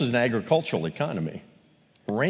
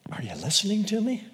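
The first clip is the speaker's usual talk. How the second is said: mock nagging wife; 7 semitones higher, 5 dB quieter, faster than usual. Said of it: "the wife that says to her husband".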